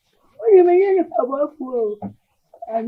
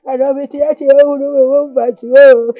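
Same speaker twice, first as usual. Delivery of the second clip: loud lament; 8 dB louder than usual. Acted. Mother's rising loud lament.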